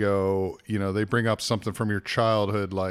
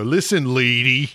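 louder, scornful Listen, lady; insulting lady; 3 semitones higher, 5 dB louder than usual. Shown as a demonstration. Showing Philly insult, scornful Listen, lady. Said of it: "the word lady is an insult".

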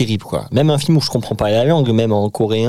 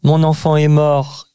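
mock news-report victim; 3 semitones higher than usual. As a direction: read it like a news report voice-over. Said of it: "quand je dis aux infos". (voix de reportage imitée)

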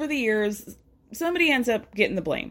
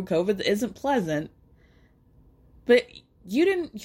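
usual speaking voice

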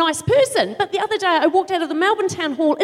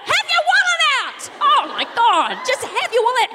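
exasperated shout; 8 semitones higher than usual. Real, not acted. At shoppers at the checkout, exasperated shout. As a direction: bark it at the dawdler.

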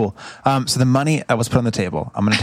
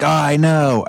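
sudden raised-pitch commiseration; impulsive agreement; 5 semitones higher, 4 dB louder, slower than usual. Acted. Player's character blurts agreement with the eavesdropped elf, on impulse. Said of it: "I'm gonna be like".

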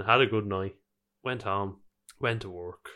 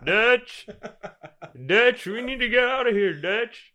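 much higher, louder cowboy drawl with laughter; Arthur Morgan accent; 12 semitones higher, 6 dB louder, slower than usual. Acted. Gamer imitating the Red Dead character to his co-host, laughing cowboy accent. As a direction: call out like a desperate outlaw. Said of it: "the accent's so good".

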